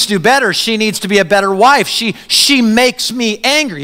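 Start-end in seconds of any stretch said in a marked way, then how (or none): none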